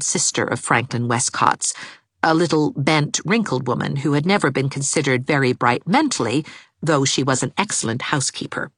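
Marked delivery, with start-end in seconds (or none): none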